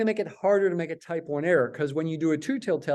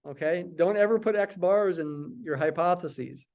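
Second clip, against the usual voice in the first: ordinary talk, nothing marked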